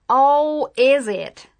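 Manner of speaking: loud, scornful sneer; mocking sheriff, loud and derisive. A narrator's reading. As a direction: sneer it in open disbelief. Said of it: "said the sheriff with a sneer".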